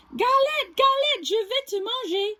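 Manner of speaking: high, light take before the correction; squeaky wrong bear voice; high mistaken take. Acted. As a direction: say it too high, not like a bear. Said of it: "Oh, I should have said".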